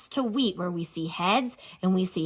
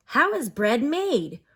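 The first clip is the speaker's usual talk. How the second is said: normal talking voice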